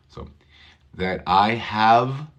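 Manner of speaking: ordinary talk, nothing marked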